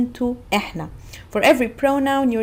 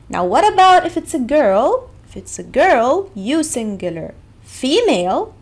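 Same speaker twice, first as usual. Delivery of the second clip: normal talking voice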